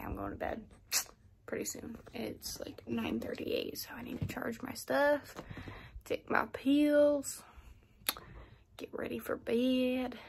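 whispering. Real, not acted. Late night vlog, whispering in bed.

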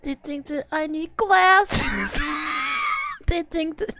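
high, slow whiny mock; mocking whine. Streamer repeats the lyric mockingly in a high whine.

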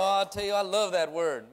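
ordinary delivery

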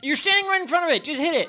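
high, loud, fast scolding; exasperated yelling. Real, not acted. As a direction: yell at a useless game character. Yelling at his game character.

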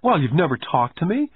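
put-on high-pitched sulky kid's complaint; acted boyish sulk; pouting. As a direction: complain with a pout. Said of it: "I said with a pout".

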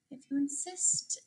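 soft, sweet little reply. A narrator's reading. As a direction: answer softly and sweetly, as a friendly ladybug. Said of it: "said the friendly ladybug sweetly".

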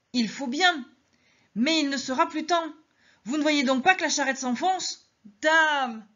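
high, pressing plea to the crowd; urgent Madeleine. Acted. Madeleine voiced pleading urgently.

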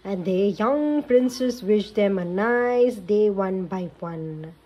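put-on lively read-aloud for kids; storybook narration. A narrator's reading. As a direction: tell it like a bedtime story. Narration continues.

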